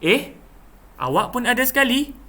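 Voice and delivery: high-pitched, surprised exclamation; puzzled surprise. Shown as a demonstration. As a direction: react as if you never expected them. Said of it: "contoh ayat dan intonasi dia begitu".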